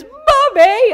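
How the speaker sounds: high, loud wail for mum; upset child. Retold or imitated. Five-year-old pleading with her mother over a toy, mock upset wail. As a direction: imitate a little girl's tantrum cry. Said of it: "She starts getting really upset".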